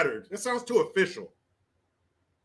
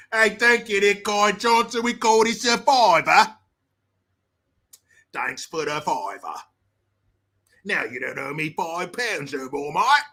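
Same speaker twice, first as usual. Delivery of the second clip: put-on London lad; mock London accent; 3 dB louder than usual. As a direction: keep up the London gangster act. Continues gangster debt bit in accent.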